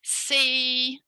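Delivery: "See" held long on one high, level note; teacher's slow, sustained "See"; model syllable. Shown as a demonstration. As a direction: draw out "See" clearly for copying. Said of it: "I'm gonna say it and you imitate me".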